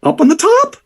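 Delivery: very high, slow goblin riddle; goblin rhyme. Acted. Goblin ticket-taker testing a confused visitor, high squeaky rhyme. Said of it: "he'll say something else like".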